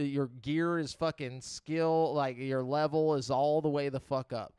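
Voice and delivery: usual speaking voice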